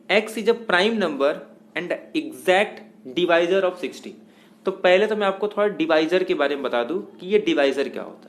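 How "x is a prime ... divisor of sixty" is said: slow, word-by-word reading of the question; spelling out the problem at a dictation pace. Shown as a demonstration. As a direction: read it out slowly so students can write it down.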